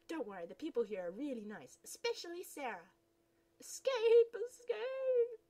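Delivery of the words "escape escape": very high and loud, panicking; panicked cry. Acted. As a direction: yell it in a rush to flee.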